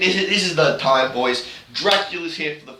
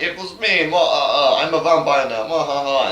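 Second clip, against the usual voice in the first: higher, hammed-up villain reveal with a cackle; mock vampire reveal; 3 semitones higher, 3 dB louder than usual. Matthias revealing himself as a vampire, higher hammed-up gloat. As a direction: gloat like a cheesy cartoon vampire. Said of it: "Matthias goes like".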